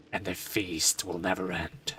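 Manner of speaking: slow, ominous low delivery; creepy. Acted. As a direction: make a feast sound sinister. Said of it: "I said that in a very creepy way".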